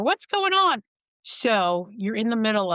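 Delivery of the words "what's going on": put-on much higher, slightly louder elderly mother asking. Mother with walker interrupting the rage, voiced higher. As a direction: pipe up like a confused old mother. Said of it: "she would come out of her room with her walker".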